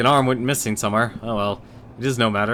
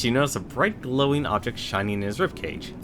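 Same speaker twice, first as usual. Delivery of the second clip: ordinary talk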